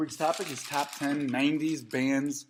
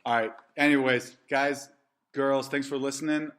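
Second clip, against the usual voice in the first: host sign-off; 7 dB louder, slower than usual. Acted. Podcast sign-off.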